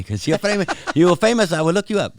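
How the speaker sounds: high, mock European accent; hammed-up accent from no known country; unidentifiable foreign accent. European tourist wanting a photo, mock foreign accent. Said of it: "no idea what accent that was".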